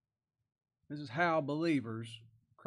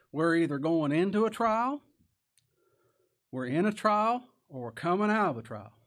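loud, raised preacher's delivery; emphatic preaching; 3 semitones higher, 6 dB louder than usual. Real, not acted. Preacher warning the church that trials always come, emphatic preaching. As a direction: drive each point home, pausing between.

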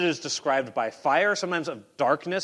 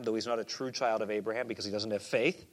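normal talking voice

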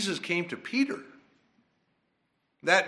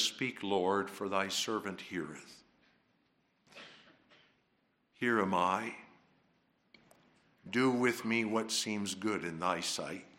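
softer, lower, reverent submission; humble prayer; 5 semitones lower, 6 dB quieter than usual. Acted. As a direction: surrender quietly to the Lord. True humility voiced as prayer.

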